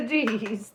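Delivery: high, loud laughing fit; loud burst of laughter. Real, not acted. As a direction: crack up at the spilled cards. Cards spill while shuffling.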